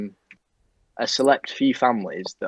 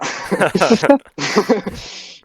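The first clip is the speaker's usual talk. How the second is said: burst of laughter; 11 semitones higher, 8 dB louder than usual. Real, not acted. Laughs at own story.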